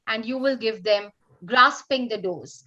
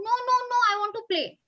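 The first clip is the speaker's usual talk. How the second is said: put-on child refusing; 9 semitones higher, much faster than usual.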